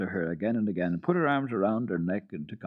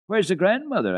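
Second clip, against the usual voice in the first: put-on high, louder child's question; girl asking; 12 semitones higher, 6 dB louder than usual. A narrator's reading. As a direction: Heidi presses the goat boy. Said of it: "asked Heidi again".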